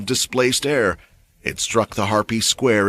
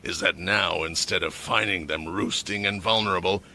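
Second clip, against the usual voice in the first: low, quiet menacing growl; dangerous growl; 3 semitones lower, 7 dB quieter than usual. A narrator's reading. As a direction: keep the threat low and steady. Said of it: "his voice dropping to a dangerous growl".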